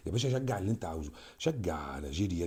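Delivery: ordinary talk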